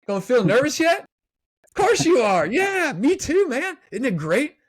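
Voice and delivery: high, loud and beaming, pumped-up hello; upbeat coach greeting. Retold or imitated. Coach walking into the locker room before a big game, high and loud with a big smile. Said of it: "a coach comes in before a big game and just kinda has a smile on their face".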